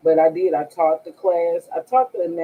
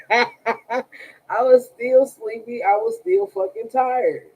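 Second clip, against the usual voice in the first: laughing; 7 semitones higher, slower than usual. Real, not acted. Laughing about sleepiness.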